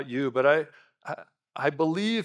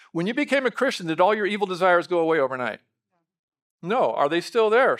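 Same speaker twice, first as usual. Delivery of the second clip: ordinary talk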